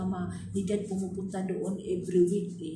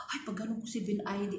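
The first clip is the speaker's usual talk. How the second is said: ordinary talk, nothing marked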